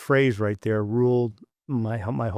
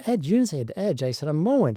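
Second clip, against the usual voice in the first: higher-pitched mock protest, a boy complaining; indignant kid; 6 semitones higher than usual. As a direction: play the kid who feels cheated, voice up. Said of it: "I go".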